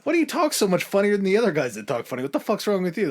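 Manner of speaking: high, fast, incredulous put-on voice; mock the newcomer's funny talk; a mock Chinese official baffled by the French.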